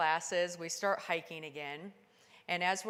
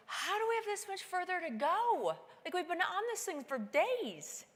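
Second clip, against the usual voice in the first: laughing disbelief; 10 semitones higher than usual. Retold or imitated. Exhausted thought at Camp Four.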